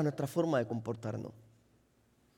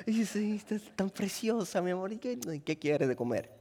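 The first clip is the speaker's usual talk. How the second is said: soft, syrupy compliments; sweet-talking husband; 10 dB quieter than usual. Retold or imitated. Husband flattering wife to get fed, soft syrupy. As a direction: charm the wife softly. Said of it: "hablas a tu esposa".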